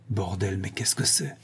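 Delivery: horrified hushed whisper; shocked whisper. Acted. Marcel seeing the bloody body, a shocked whisper. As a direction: breathe it out in horror. Sees man covered in blood.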